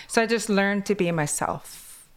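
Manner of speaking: usual speaking voice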